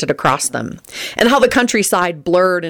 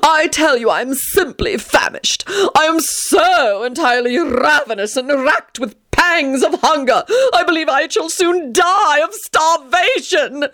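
theatrical whining; 10 semitones higher than usual. Acted. Tony complaining to Teddy on the train, high melodramatic whine. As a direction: wail about his throat like a dying aristocrat. Tony's voice, hunger lament.

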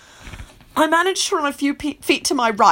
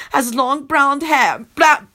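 usual speaking voice

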